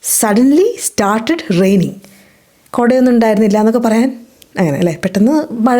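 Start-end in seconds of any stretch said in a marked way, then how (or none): 0.0-1.9 slow, careful teacher read; deliberate classroom pronunciation; slow model sentence. Shown as a demonstration. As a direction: spell it out slowly for learners. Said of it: "അപ്പോൾ it".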